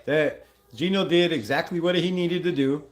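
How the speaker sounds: normal talking voice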